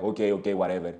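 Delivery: low, dropped brush-off; dismissive backing down. Retold or imitated. Mafia guy told off by the Venezuelan, dismissive. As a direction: imitate someone grudgingly giving up.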